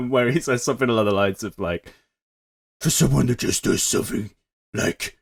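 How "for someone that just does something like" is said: mock-solemn growl, dragged out; Batman. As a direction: play an emotional moment through the gruff disguise. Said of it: "he's still doing the voice".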